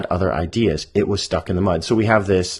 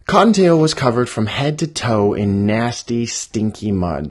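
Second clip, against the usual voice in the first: put-on lively children's story read-aloud; 4 semitones higher, 5 dB louder than usual. Author reading her draft to the camera, lively. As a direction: read it out to young kids.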